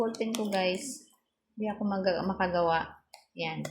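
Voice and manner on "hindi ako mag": usual speaking voice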